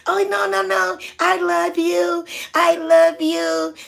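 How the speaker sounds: high, slow, gushing woman's voice; mimicking a tipsy cruise lady; gushing drunk lady. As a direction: coo it high and drawn out like a tipsy fan.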